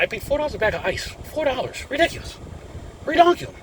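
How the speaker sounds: high-pitched disbelief at the price; rising outrage over four-dollar ice; exasperated. Real, not acted. Dad griping to viewers about ice prices, exasperated.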